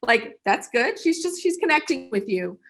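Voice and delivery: ordinary talk, nothing marked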